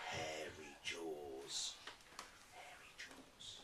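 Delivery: soft slow creepy voice; storyteller's hushed spooky echo. Acted. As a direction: drop to a scary near whisper. Spooky monster description.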